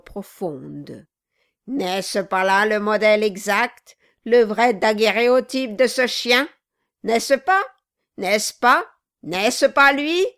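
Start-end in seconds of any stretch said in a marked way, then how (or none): 1.7-10.2 loud, rising jeers; the dwarf bellows while beating the carved head; Quilp yelling. Acted. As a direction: hammer each question home as you strike. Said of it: "chaque fois qu'il répétait cette question".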